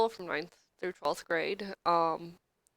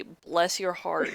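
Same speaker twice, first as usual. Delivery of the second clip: slow sincere quote; slower than usual. Retold or imitated. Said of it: "heard anyone say".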